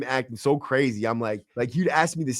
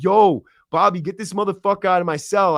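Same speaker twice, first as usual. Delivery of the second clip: raised, higher, exasperated call; urgent retold order; 6 semitones higher, 4 dB louder than usual. Inmate telling Bobby to clear his cell, urgent.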